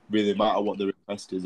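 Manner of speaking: ordinary talk, nothing marked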